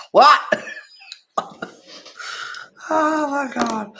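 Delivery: high, loud laughing "what?"; a real incredulous laugh; laughing disbelief. Real, not acted.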